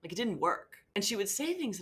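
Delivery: ordinary talk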